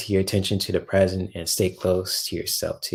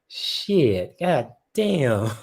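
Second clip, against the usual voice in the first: ordinary talk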